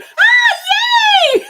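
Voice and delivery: high, loud squeal of glee; imagined delighted child. Pretend. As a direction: squeal as an overjoyed kid would. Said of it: "she's gonna be like".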